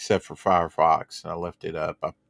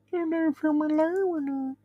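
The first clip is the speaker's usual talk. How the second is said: squeaky silly voice. Pretend. Echoing the chat in a funny voice.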